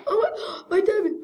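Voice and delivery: high, loud mock sob; distraught wail. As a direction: gasp and wail in pretend heartbreak. Pretend grief over stolen diamonds.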